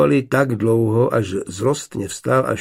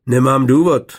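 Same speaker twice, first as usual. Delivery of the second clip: stiff, louder reply; 3 semitones higher, 7 dB louder than usual. A narrator's reading. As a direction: answer stiffly, holding back. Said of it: "opáčil upjatě". (upjatá, hlasitější odpověď)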